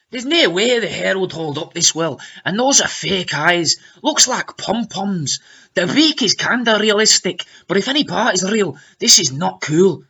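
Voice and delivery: put-on Scottish lilt; mock Scottish accent, swooping pitch; strangely acquired Scottish accent. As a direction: read it out in dodgy Scots. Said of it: "in a strangely acquired Scottish accent".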